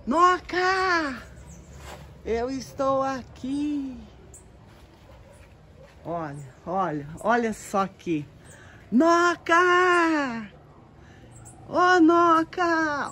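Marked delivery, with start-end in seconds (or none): 0.1-4.0 high calling roots, fading at the end; plants shouting. Pretend. 8.9-13.1 high, loud, drawn-out call; the roots calling loudly for Noca. Pretend.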